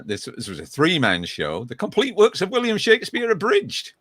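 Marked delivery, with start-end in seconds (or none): 1.6-3.9 higher-pitched, theatrical announcer flourish; grand title announcement. Pretend. Host showing off a past three man show, grand announcement. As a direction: proclaim the title like a showman.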